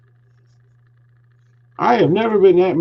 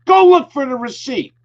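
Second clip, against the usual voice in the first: much higher and louder, falling demand to check the receipt; retold angry order; 12 semitones higher, 4 dB louder than usual. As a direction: bark the order again, pitched high and loud. Repeats demand to look.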